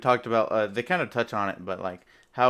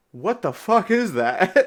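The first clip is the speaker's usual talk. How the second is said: startled question; 5 semitones higher, 5 dB louder, slower than usual. Acted. Confused Cap reacts to microwave.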